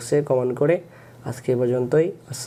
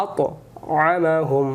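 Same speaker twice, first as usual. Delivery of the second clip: slow recitation; much slower than usual. Shown as a demonstration. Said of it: "recites word as model".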